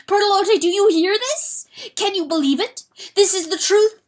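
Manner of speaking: loud, high desperate confession; frenzied confession. Acted. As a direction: pour out the guilt in a frenzy. Rooster continuing frantic confession.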